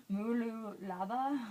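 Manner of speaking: slow, puzzled try at "Mooloolaba"; slow, halting "Mooloolaba" with a questioning lift; unsure pronunciation. Shown as a demonstration. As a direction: sound out "Mooloolaba" carefully, unsure. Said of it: "no idea how to pronounce".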